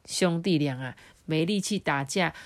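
ordinary delivery, nothing marked

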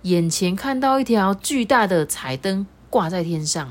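ordinary delivery

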